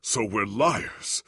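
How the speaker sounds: normal talking voice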